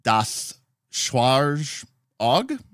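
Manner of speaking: "Schwarzauge" very slowly, word by word, with a questioning rise; non-German attempt. Shown as a demonstration. Streamer unsure of a German game title, non-German attempt at "Schwarzauge". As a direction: sound out "Schwarzauge" haltingly in American English. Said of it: "not even going to try to pronounce that in actual German".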